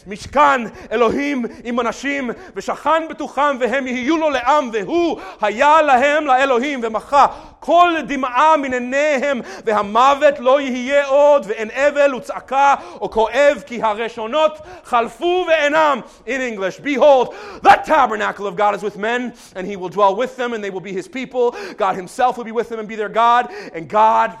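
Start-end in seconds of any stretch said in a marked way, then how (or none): 0.1-16.0 high, loud Hebrew proclamation; Jesus shouting in Hebrew. Retold or imitated. Jesus shouting over the world in Hebrew, loud. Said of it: "he's shouting". 16.8-24.3 high, loud proclamation; shouting in English. Retold or imitated. Jesus's shout rendered in English, loud proclamation. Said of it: "he's shouting".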